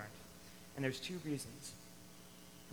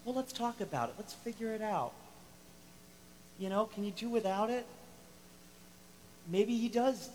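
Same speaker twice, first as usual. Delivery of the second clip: higher, soft, accommodating counsellor voice; gentle mediator; 7 semitones higher, 3 dB quieter than usual. Retold or imitated. Pastor showing how he'd gently negotiate instead, soft and higher. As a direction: coax them to talk it out, softly. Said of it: "I love to kind of".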